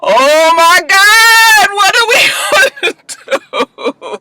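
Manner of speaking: drawn-out, very high hammed-up wail; exaggerated slow wailing, breaking into laughter; mock wail. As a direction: stretch it into a despairing mock cry. Acting out Annalise's despairing reaction.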